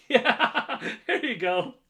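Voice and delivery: high, loud laughing cheer; laughing praise. Real, not acted. Owner giving Peach the treat, laughing praise. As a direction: laugh through the words as you reward.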